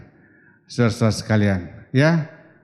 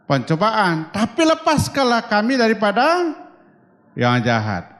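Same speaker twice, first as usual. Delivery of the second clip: prayer recitation; 5 semitones higher than usual. Acted.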